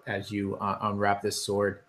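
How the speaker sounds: ordinary talk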